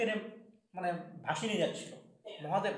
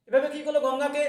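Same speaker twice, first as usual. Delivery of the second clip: loud rhetorical question; 7 semitones higher, 11 dB louder than usual. A narrator's reading. Teller asks audience rhetorically.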